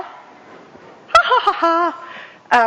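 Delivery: slow, drawn-out ha ha ha; slow mock laugh, beat by beat; demo laugh. Shown as a demonstration. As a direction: act out a big laugh for the class. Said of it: "laughing is a great big smile".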